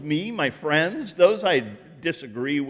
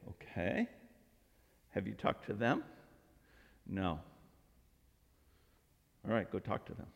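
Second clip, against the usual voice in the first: hushed, patient pastor reply; calm quiet reply; 7 semitones lower, 13 dB quieter than usual. Retold or imitated. Pastor calmly redirecting the complaining congregant, quiet and patient. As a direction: answer softly, unbothered.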